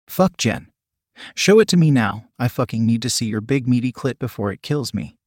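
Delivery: ordinary delivery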